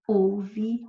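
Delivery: ordinary talk, nothing marked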